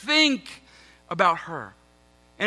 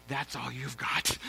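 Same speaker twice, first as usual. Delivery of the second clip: much lower and softer, slow grumble; muttered disappointment; 11 semitones lower, 14 dB quieter, slower than usual. Retold or imitated. Pastor retelling reaction to his wife's thanks, low sulky mutter. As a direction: let it drop under your breath, sulky. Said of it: "I was like".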